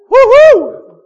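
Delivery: loud, high-pitched cheer; celebratory whoop. Acted. Preacher celebrates owning twenty books, loud high whoop.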